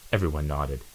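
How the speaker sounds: plain reading voice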